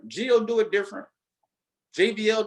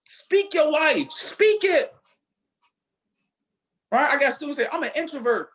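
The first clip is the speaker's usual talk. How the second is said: fiery preaching; 5 semitones higher than usual. Acted. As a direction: fire the room up like a preacher. Preaching to students about speaking.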